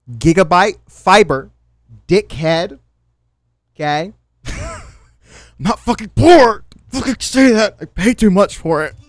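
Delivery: heated, loud rant; angry rant. Real, not acted. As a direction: fire back at the insult.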